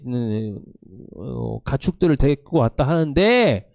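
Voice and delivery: usual speaking voice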